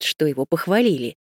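usual speaking voice

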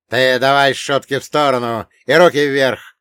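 loud threatening command; shouted order. Acted. Second gunman ordering the narrator, shouted command. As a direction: raise the voice to order surrender. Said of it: "крикнул второй".

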